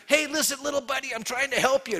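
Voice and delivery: mock-gentle plea to a biting critter; coaxing a trapped creature. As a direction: soothe a frightened little animal. Talking to a trapped animal.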